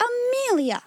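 high, scandalized cry of a name; infuriated gasp. A narrator's reading. Infuriated elder sister cuts Amelia off, high outraged gasp. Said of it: "gasped her infuriated elder".

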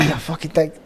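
muted aside under the main story; retold aside to himself. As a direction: drop the volume for a private thought. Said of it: "to myself".

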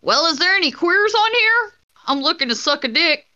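high, loud put-on caller voice; chat-line caller act.

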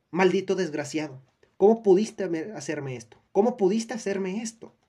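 loud, high furious cry; furious yelling. A narrator's reading. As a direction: yell it at him, betrayed. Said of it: "gritaba mientras su rostro".

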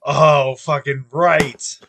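loud, slow, drawn-out approval; delighted. Real, not acted. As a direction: savour every word of the praise. Delight at the cigar case.

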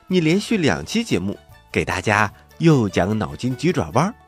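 normal talking voice

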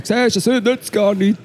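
high mocking sceptic voice, lightly done; a hint of a smug naysayer; imitating anti-vaxxers with a high, mocking voice; mocking vaccine sceptics. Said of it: "vierhundert ImpfgegnerInnen hinten vorkommen und sagen".

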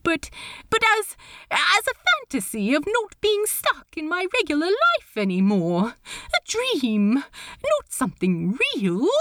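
a hint of a nervous, fussy gentleman; Steed, flustered. A narrator's reading. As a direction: rush the words, tripping over them. Steed flustered, explaining himself.

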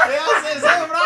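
laughing yell. Real, not acted. Shouting at Efra.

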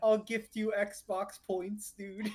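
high, drawn-out mock kid voice; cringey Xbox kid. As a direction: beg and bribe like an overeager little gamer. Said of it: "little cringe ass Xbox kid's like".

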